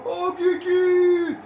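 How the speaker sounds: high, loud, slow goal call; excited sports commentary. Real, not acted. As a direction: shout it like a live commentator. Player calls a stunning goal.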